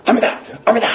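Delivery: high, urgent cry of alarm; acted alarm. Residents spot rain on the drying laundry, urgent cry. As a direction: cry out as if a downpour just hit, pitch leaping up.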